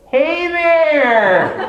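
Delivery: high, exaggerated cheerful voice to a stranger; talking down like to a child. Mocking talking to someone with glasses.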